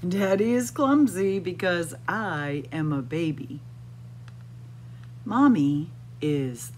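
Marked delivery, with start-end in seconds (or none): none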